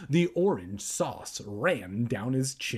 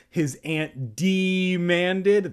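slow, loud drawn-out tag; 5 semitones higher, 6 dB louder, much slower than usual. Acted. Narrator lingering on the aunt demanding, slow. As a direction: stretch the words.

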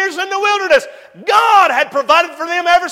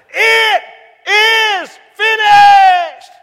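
loud and very slow, higher; shouted; 5 semitones higher, 5 dB louder, much slower than usual. Acted. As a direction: cry out the last words from the cross. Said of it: "He shouted".

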